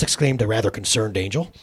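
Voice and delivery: plain reading voice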